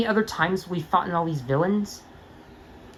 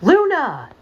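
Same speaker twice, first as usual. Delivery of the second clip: high, loud excited shout of a name; surprised call; 8 semitones higher, 6 dB louder than usual. Acted. Friends spot Luna arriving, surprised call.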